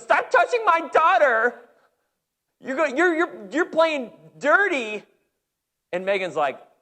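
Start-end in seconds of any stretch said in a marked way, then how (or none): 0.0-5.0 high, loud, slow shrill-mom yell; mock yelling mom. Imitating sideline moms yelling at his daughter, high and loud. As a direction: shout like an outraged sideline mom. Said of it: "these moms are yelling".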